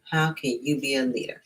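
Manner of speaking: ordinary delivery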